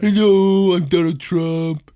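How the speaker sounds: slow, loud drawn-out greeting; Donald Trump. Acted. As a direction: stretch the words in a caricature president voice. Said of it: "It's a really good one".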